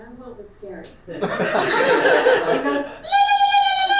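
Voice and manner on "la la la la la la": loud rapid trilled la-la cry; mock ululation. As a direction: let out a parody ululation as the GPS.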